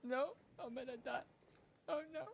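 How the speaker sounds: shrill despairing cry; high-pitched wail. Real, not acted. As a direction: wail as you die in the game. Dying in game, wailing.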